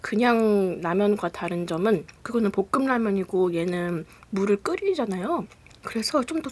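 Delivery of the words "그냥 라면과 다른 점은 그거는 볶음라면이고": speaking with food in mouth; words between bites; talking while chewing. Real, not acted. Seen 1: Eating before; lips pressed, cheeks working at 0.4, mouth still busy.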